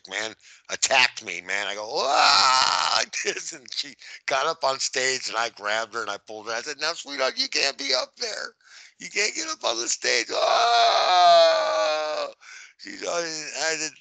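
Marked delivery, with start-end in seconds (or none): 1.9-2.9 high startled "wah!" yell; sudden high yelp, played up. Bouncer retells being jumped by a fan, startled yell. 6.8-10.3 coaxing. Retold or imitated. Security guard retells talking a fan offstage, high coaxing. 10.3-12.3 very high held "oh" scream; mock scream. Bouncer acting out the stage tussle, high mock scream.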